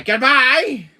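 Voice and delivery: high loud goodbye while fleeing; loud hurried goodbye. Acted. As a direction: shout it as you run. Fleeing the girl.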